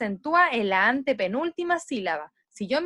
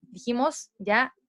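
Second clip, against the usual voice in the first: ordinary talk, nothing marked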